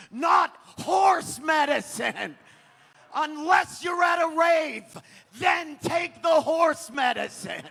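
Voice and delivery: screamed high and loud, words stretched slow; furious rant. Real, not acted. Comic yelling at anti-vaxxers in the crowd, furious shouting. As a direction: unload on them at full volume.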